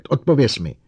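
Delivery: high, loud command; furious, forceful order; roared demand. A narrator's reading. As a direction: shout for an answer. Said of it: "zařval Bogert".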